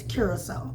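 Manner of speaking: Curacao said "cure-a-so"; correct pronunciation. Shown as a demonstration. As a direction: end Curacao on "oh", as in "so". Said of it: "the correct pronunciation is".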